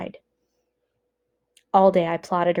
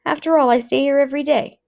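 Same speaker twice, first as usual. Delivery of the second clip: a higher, slightly louder voice, offering hollow comfort; lightly voiced block elder; 8 semitones higher, 4 dB louder than usual. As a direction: brush off their fear with a thin excuse. Block elder's flimsy reassurance.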